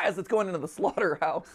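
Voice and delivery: ordinary talk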